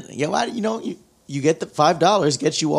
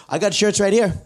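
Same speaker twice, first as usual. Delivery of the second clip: 1 mock shirt hawker; 9 semitones higher than usual.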